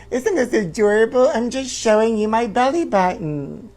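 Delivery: a hint of a squeaky toy doll. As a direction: speak for the doll cutely. Voicing the doll.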